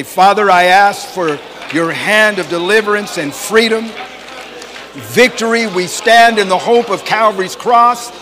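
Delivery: loud, high, full-force preacher's prayer; fervent prayer. Real, not acted. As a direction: cry out to God over the congregation.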